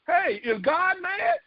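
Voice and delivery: ordinary talk, nothing marked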